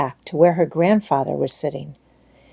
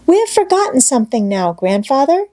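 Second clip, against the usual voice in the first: higher and fast, a sudden thought; quick eager child; 6 semitones higher than usual. A narrator's reading. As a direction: blurt it out like a little girl. Heidi remembers the coverlid.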